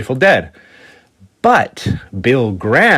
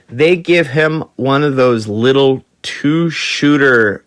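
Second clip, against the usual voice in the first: ordinary talk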